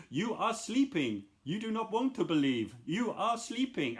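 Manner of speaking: lightly voiced creepy hypnotist lulling you. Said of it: "quite terrifying when I was little".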